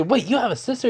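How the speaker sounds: high-pitched astonished question; shocked. Acted. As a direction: ask it in mock shock, pitch jumping up. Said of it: "all the guys are in shock".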